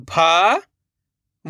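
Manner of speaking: each syllable sounded separately, pauses between; letter by letter. Shown as a demonstration. Teacher sounds out a word for beginners, letter by letter. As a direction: break the word into its letters, slowly. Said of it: "एक ही अक्षर का उच्चारण".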